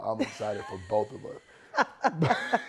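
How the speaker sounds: joking through a laugh. Real, not acted. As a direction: giggle out the joke. Joking about shared birthday.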